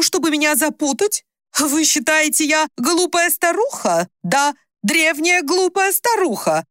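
high, drawn-out outrage; indignant accusation. A narrator's reading. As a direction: climb into offended, scornful protest. Mother indignantly accuses an impostor.

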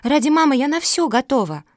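high, loud reckless vow; impassioned childlike outburst; going wild. A narrator's reading. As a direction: declare it with frantic devotion. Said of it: "пошла в разнос Мафуля".

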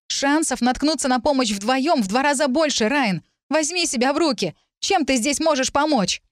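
high-pitched, exasperated argument; urgent scolding. A narrator's reading. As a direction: argue hard to make him go. Acted quarrel, ordering Ryan to go. (высоким голосом, резко убеждая)

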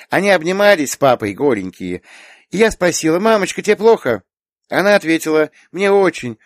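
put-on small girl recounting innocently, pitched high; earnest little girl. A narrator's reading. Little girl tells aunt a memory, innocently earnest.

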